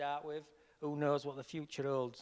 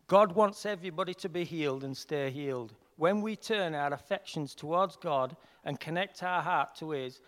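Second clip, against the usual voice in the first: louder, earnest reading of a written text; read aloud; 7 dB louder than usual. Shown as a demonstration. Reading his healing description to the group, earnest and loud. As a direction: read out a heartfelt statement of faith. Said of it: "read my description of healing".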